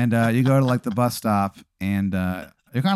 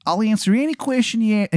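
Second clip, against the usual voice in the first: high-pitched, hammed-up Maryland voice; bad Maryland accent; horrible Maryland accent; 9 semitones higher than usual. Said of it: "the accent is just".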